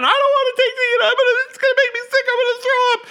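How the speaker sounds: very high, fast, loud crying whine; panicked kid. Retold or imitated. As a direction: wail it like a terrified child. Child's tearful refusal.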